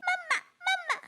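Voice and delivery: put-on very high, wailing child-bird voice; crying. Fallen little bird calling mother, crying. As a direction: cry out for your mother. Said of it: "रोने लगती है".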